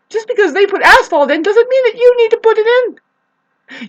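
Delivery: high, loud, pleading reproach; exasperated scolding. Real, not acted. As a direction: raise your voice and scold them.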